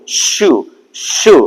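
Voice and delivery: ಶು said alone with a clear sh and oo; hushing sh sound. Shown as a demonstration. Teacher showing the sh type, isolated hushing ಶು. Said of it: "ಶ್ ಅಂತ ಹೇಳಿ ಕರಿತೀವಲ್ಲ".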